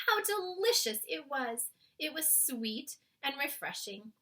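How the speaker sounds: plain reading voice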